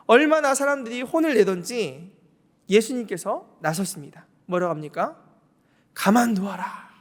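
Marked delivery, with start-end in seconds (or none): 5.9-6.9 Jesus defending her. Acted.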